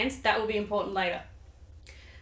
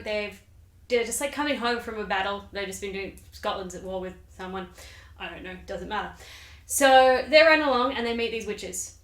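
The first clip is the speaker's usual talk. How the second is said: usual speaking voice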